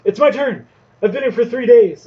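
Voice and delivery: high, loud, fed-up outburst; impatient shout. Acted. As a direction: yell it like a customer who's waited for ages. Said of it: "sort of pipes it up and goes".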